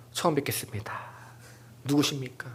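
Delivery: soft, polite, distant greeting; mock stranger greeting. Afternoon churchgoer pretending not to know God, soft and cool.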